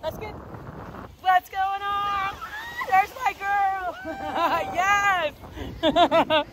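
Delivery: light up as you spot her; excited greeting. Real, not acted.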